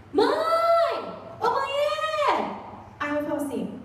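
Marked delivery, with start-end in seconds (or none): 0.1-2.3 high yelled call for mom; daughter calling out. Retold or imitated. Preacher voicing a teen daughter, calling her mom loudly. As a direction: call out to mom from another room, high and loud.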